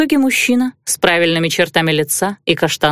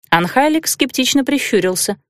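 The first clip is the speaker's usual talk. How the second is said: ordinary delivery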